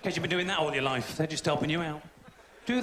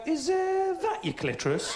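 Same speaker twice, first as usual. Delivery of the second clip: clueless bloke asking; 10 semitones higher than usual. Retold or imitated. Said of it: "if you go".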